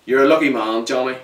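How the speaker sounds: a loud taunt; mocking shout. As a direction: call it out as a sneering farewell. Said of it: "one of them shouted".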